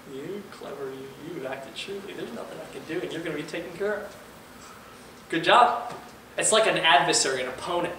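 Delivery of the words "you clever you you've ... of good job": hushed, admiring master, only a little like a figure; hushed master's praise. Retold or imitated. Master quietly praising his clever steward, hushed and admiring. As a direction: murmur the praise like an impressed master.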